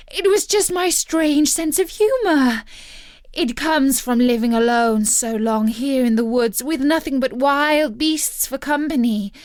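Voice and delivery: high, loud frantic pleading; begging for mercy. Acted. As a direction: beg the hero to spare her. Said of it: "let out a shriek ... begging for mercy".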